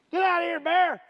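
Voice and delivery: yelling at a bear; scaring off a bear. Acted. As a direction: shout it to chase him off.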